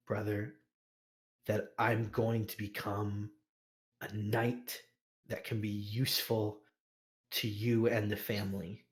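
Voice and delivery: quiet, earnest vow to his brother; a hushed brother's promise, lightly voiced. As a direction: swear it softly and seriously. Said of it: "Garrick sounds, like, a little more serious".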